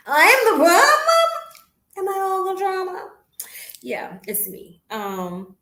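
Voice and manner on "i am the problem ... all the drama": high, laughing mock shock at herself; mock disbelief. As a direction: gasp it out like you can't believe it's you. Mock shock at own realization.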